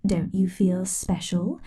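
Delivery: ordinary delivery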